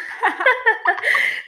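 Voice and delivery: high, loud burst of laughter; real burst of laughter. Real, not acted. Friend laughs at relatable story.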